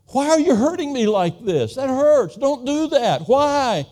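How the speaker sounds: imitating a crying toddler begging her father; small daughter pleading. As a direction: wail it like a hurt little girl. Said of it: "I could just hear her saying".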